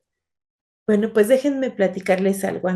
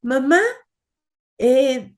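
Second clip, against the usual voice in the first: higher calls for mama, rising; calling out; 9 semitones higher than usual. Acted. Marina calling her mother in the dark house, rising calls. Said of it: "lo primero que hizo fue gritar".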